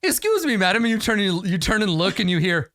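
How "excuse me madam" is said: much higher, prim little interruption. Acted. Unseen voice from behind Bryn, prim and high. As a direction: pipe up politely, very high. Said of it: "sort of prim, uh, voice".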